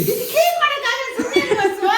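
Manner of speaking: loud, very high-pitched protest; real high-voiced scolding; exasperated scolding. Real, not acted. Mother scolds son to stop.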